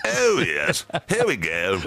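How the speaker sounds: plummy upper-class English voice; posh voice. Pretend. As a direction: go mock-posh, high and grand. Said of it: "with my very posh voice".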